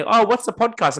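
curious stranger. Retold or imitated. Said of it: "And they".

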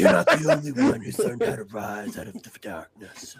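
low hero voice, on the edge of laughter; Batman. Acted.

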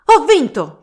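high, loud victory cry; triumphant proclamation. Acted. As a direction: crow in triumph. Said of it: "proclamò a Sancho Panza".

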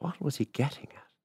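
hushed, suspicious whisper; whispered thought. A narrator's reading. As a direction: whisper it as a puzzled inner question. Woman's private suspicious thought.

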